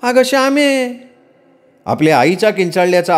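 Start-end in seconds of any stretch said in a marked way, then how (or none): 0.0-1.0 shrieking mother. Acted. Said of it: "Paru मोठ्यानं किंचाळली".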